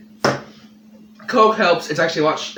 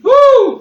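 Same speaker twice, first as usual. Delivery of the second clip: pained whoop; 12 dB louder than usual. Real, not acted. Burning mouth reaction.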